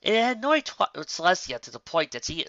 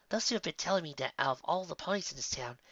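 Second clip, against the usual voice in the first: plain reading voice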